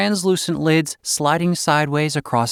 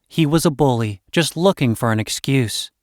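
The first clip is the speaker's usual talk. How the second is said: ordinary delivery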